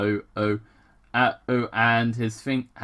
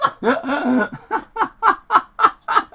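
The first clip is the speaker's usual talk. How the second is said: loud, high giggle fit; high cackling laugh; 4 dB louder than usual. Real, not acted. Vlogger cracking up at the alien joke, high cackle. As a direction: crack up at your own joke.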